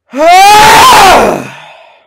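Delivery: long loud high groan of defeat; anguished yell. Real, not acted. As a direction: let out a long pained yell. Frustrated groan after losing.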